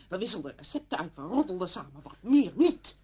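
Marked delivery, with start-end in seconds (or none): 2.2-3.0 very loud, high, final denial; sharp, shouted cut-off; angry emphasis. Acted. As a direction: hammer both words home. Friend repeats the denial angrily.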